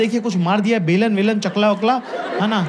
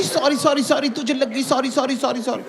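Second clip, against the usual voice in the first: higher, quick repeated sorry; mock apologetic; 5 semitones higher than usual. Mocking girlfriend's frantic apology after his nosebleed. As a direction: gush the sorries up high.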